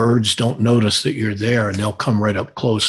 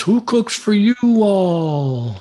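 high, slow Southern drawl hoot; Southern owl call; 10 semitones higher, much slower than usual. Retold or imitated. Imitating a barred owl with a Southern accent for a caller. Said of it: "if he's from the South".

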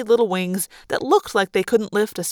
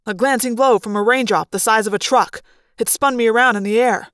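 ordinary delivery, nothing marked

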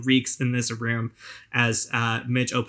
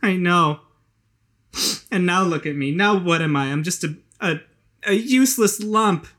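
put-on self-pitying drunk woman; 9 semitones higher, 4 dB louder than usual. Hazel wallows in despair.

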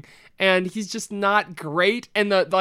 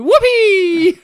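high, loud whoop of joy; excited cheer; 11 semitones higher, 8 dB louder than usual. Real, not acted. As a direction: cheer it out, thrilled. Celebrating baby news.

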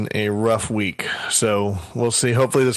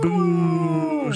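mock boo; 13 semitones higher than usual. Booing that Gen Con is over.

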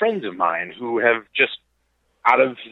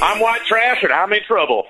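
mock ad announcer; 8 semitones higher than usual. Mock podcast title as ad.

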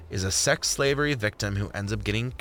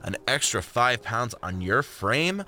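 plain reading voice

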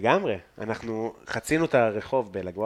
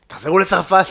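raised, louder mock heckle; retold heckle; 5 semitones higher, 7 dB louder than usual. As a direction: throw it out higher and louder, mockingly. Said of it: "כל מיני, אה, משפטים".

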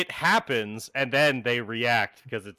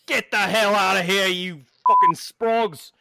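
loud, higher-pitched British outburst; stammer, then yell with a British accent; flustered British bartender; 6 semitones higher, 6 dB louder than usual. Acted. Bartender throwing out whoever kissed him, flustered British shout. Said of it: "immediately starts stuttering and is like".

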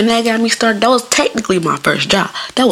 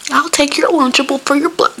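higher-pitched mock kid voice; movie kid; 8 semitones higher than usual. Imitating movie kids trading snacks at lunch, mock kid voice. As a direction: put on a high little kid's voice. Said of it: "kids in movies trading snacks".

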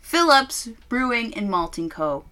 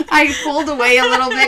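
laughing; 6 semitones higher, 7 dB louder, much faster than usual. Real, not acted. Laughing after the scare.

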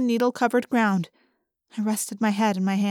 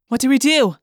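quick-fire, louder demand; terse; 3 semitones higher, 5 dB louder than usual. A narrator's reading. Older Rachel demanding orders from Visser Three, terse. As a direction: press for an answer, curtly. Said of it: "the older Rachel said tersely".

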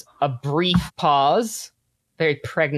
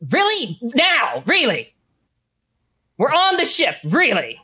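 high, loud disbelief; incredulous; 10 semitones higher, 4 dB louder than usual. A narrator's reading. Henchman protests detonating on the ship, high and shocked.

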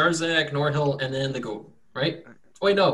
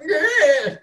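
high, louder wail of a farmer at a loss; farmer's distressed cry; 5 dB louder than usual. Acted. Farmer grabs his head, high despairing yell. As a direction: cry out with a laugh in it. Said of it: "and he's like".

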